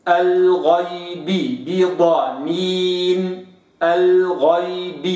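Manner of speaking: slow الغيب بضنين, lips sealed; slow tajweed reading; lips closed. Shown as a demonstration. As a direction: recite الغيب بضنين slowly. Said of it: "هكذا".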